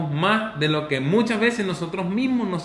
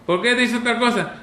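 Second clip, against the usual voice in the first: higher, louder scolding; scolding parent; 7 semitones higher, 4 dB louder than usual. Acted. Parent scolding a boy, higher and louder. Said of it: "un muchacho regañándolo".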